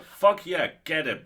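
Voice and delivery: shouted high and loud, cheering on; loud triumphant cheer; gleeful cheer. Retold or imitated. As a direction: whoop like a fan cheering the heroine. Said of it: "you can't not read that and go".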